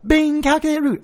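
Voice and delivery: high, chirpy "Bing, calculate route"; mock GPS chime. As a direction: play a cheery robotic navigator. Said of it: "she was just like".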